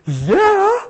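loud, very high giddy yell; silly elation. Retold or imitated. Minister cracked up by a revelation, silly elation. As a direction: burst out giddily, pitch shooting up. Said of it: "I got silly".

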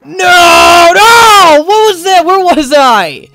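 high, loud drawn-out cry of dismay; loud, anguished gamer yell; dismayed shout. Real, not acted. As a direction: wail it out in shocked protest. Sudden mishap in game.

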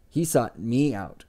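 HE SOUGHT ME OUT, slow and weighted; particular emphasis. A narrator's reading. Chronicler bragging of his subject, He sought me out emphasized slowly. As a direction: lean deliberately on each word of He sought me out. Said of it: "said with particular emphasis".

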